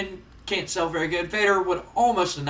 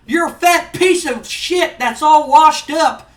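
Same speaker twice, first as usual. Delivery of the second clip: high, loud, scornful manager rant; Jim Cornette promo; 9 semitones higher, 8 dB louder than usual. Acted. Manager berates Vader for his challenge, loud angry promo. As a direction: tear into him at full volume, pitched up. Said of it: "he gets into the ring and he goes".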